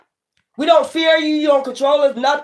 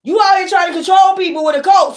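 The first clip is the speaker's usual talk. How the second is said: real yell; 7 semitones higher than usual. Real, not acted. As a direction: shout it out, call them out. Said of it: "when y'all hear me yell like that".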